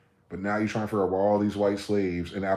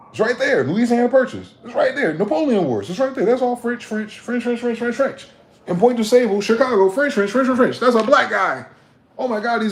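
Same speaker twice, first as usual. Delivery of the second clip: heated ranting; 13 semitones higher, 6 dB louder than usual. Real, not acted. Ranting, listing French claims.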